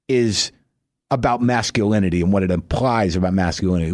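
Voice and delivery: ordinary talk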